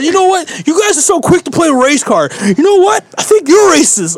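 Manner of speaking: high-pitched mimicry of an outraged man; mock the neighbor's worked-up accusation; indignant comeback. Accused neighbor turning it back on the storyteller, indignant.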